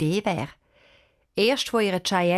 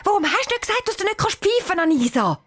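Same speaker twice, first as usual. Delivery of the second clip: high, louder, fast angry quarrel; 13 semitones higher, 4 dB louder, faster than usual. Acted. Girls blaming each other after losing Janik, angry. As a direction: snap back at your friend. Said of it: "sagt Anisa hässig".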